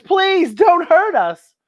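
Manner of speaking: high, slow begging; pleading monster. Acted. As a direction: plead timidly for mercy. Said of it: "another monster says".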